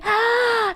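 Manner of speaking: a sharp, high delighted gasp. Real, not acted. Gasping as a friend offers to share a link. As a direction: catch your breath in surprised delight.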